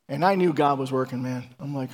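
ordinary delivery

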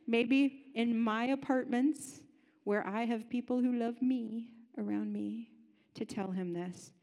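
ordinary talk

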